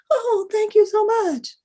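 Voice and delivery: high, loud, relieved gushing thanks; embarrassed woman thanking the stranger. Retold or imitated. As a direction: gush with sheepish relief. Embarrassed gratitude.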